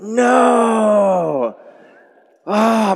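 high loud anguished cry. Retold or imitated. Preacher retelling his breakdown in the car, anguished crying shout. Breaking down when music plays.

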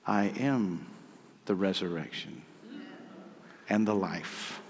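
soft, low, with weighty pauses; hushed, deliberate scripture reading; solemn declaration. A narrator's reading. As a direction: let each phrase settle quietly. Jesus' solemn declaration, read aloud.

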